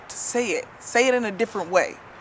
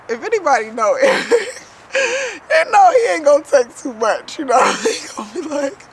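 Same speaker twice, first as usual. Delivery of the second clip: giggling through the words, louder and higher; laughing while telling; 5 semitones higher, 7 dB louder than usual. Real, not acted. Telling viewers about her husband Eric, laughing.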